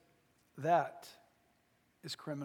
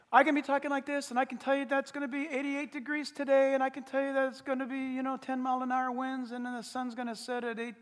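monotone, slightly raised pitch, running on; droning talk; 3 semitones higher than usual. Shown as a demonstration. Preacher demonstrates dull weather talk, droning monotone. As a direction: reel it off on one note. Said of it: "drone on".

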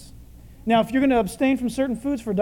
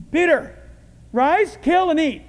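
loud, high, separated orders; commanding call; 5 semitones higher, 5 dB louder than usual. Acted. God calling Peter from heaven, commanding.

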